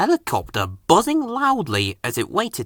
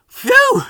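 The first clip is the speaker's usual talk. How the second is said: phew; 5 dB louder than usual. A narrator's reading. Hot fireman puffs.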